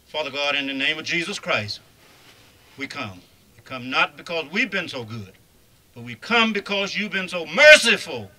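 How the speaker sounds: rising preacher's prayer, building loud; fervent prayer. Acted. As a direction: pray it out with fervor. Opening prayer at funeral.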